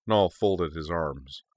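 ordinary delivery

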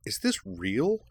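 suspicious disbelief. A narrator's reading. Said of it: "looked suspiciously around".

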